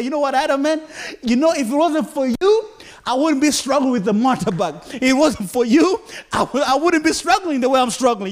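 lightly voiced chat with Adam, man to man; pretend talk to Adam. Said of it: "I'll talk to Adam just like that".

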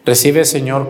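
ordinary delivery

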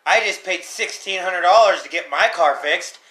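heavy sarcasm. Real, not acted. Topping friend's gripe about car costs, heavy sarcasm. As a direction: lay the sarcasm on thick.

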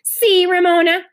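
ordinary delivery, nothing marked

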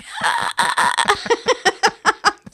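high burst of staccato laughter; re-enacted laugh. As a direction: act out your own laughing reaction in a long high peal. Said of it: "And I was like".

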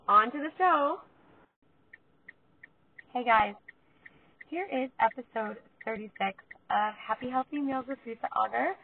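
ordinary talk, nothing marked

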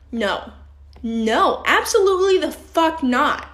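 real heated rant, loud emphatic bursts. Real, not acted. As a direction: hammer out each objection angrily. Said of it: "Sorry, I just got heated".

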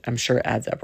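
ordinary delivery

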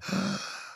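sharp audible singer's inhale; deep breath in. Shown as a demonstration. Engineer mimicking a singer's breath, deep gasp. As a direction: gasp in air before a note. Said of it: "take a deep breath".

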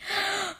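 audience's audible gasp; great gasp. A narrator's reading. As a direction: draw in a shocked breath. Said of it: "A great gasp went up".

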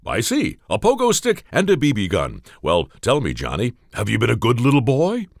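put-on a kindly Santa quizzing a boy. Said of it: "Santa Claus".

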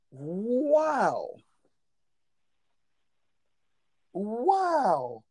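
long, drawn-out wow, rising high then falling; stunned wow. Retold or imitated. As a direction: stretch it out in retold disbelief. Reacting to girl's simp remark.

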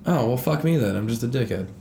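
ordinary talk

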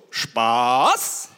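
low drawn-out SPASS swooping up at the end; demonstrated intonation. Shown as a demonstration. What the dog hears instead of no, "Spaß" low and rising. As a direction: say "Spaß" low, then slide it sharply upward. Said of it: "was versteht ein Hund?".

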